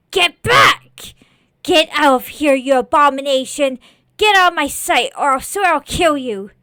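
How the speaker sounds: very loud, threatening shout; furious yelling. Acted. Boy threatens to kill the scarecrow, furious yelling. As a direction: shout it like driving off an abomination. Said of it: "Please stop yelling".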